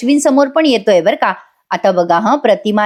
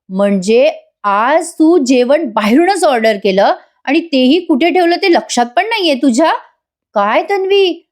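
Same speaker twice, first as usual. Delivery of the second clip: mock-innocent jibe climbing in pitch; taunting; 4 semitones higher than usual. Asmita mocks Tanvi over the lost parcel, taunting. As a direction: needle her with rising, pointed questions. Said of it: "अस्मिता टोमणा मारते बरं का तिला".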